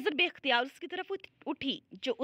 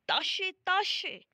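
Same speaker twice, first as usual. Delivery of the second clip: higher, drawn-out calling of a name; desperate call; 7 semitones higher, much slower than usual. Acted. Shuja calls after fleeing Tashe in his dream, desperate call. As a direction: call out slowly and high, pleading.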